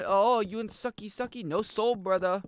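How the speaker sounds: high-pitched mock Vietnamese accent. Streamer riffing on Full Metal Jacket lines, high Vietnamese accent. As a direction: quote the film in a put-on Vietnamese accent. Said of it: "That's why I could do the accent".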